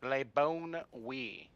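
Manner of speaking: slow Texan drawl on French; drawn-out Texan accent. Shown as a demonstration. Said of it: "Texan accent".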